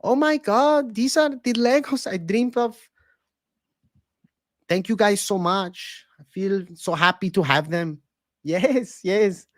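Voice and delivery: put-on high, accented fighter voice, delighted; Brandon Moreno impression. Streamer as fighter thanking fans for Legos, high accented gushing. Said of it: "Moreno opening a new set of Legos".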